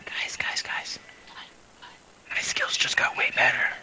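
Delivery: excited hushed whisper. Real, not acted. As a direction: whisper it like a secret thrill. Excited whisper about skills.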